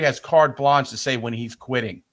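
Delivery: normal talking voice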